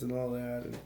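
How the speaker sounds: ordinary delivery, nothing marked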